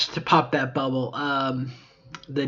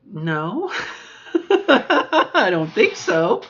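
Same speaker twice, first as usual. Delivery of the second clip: high, slow, louder protest; mock-modest high drawn-out no; drawn-out disbelief; 7 semitones higher, 6 dB louder, much slower than usual. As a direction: stretch out the denial in a higher voice. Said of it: "And I was like".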